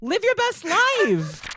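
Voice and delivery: slow, high, sing-song camp delivery; mock sassy high voice. Host voicing a fabulous character for co-host, slow high camp.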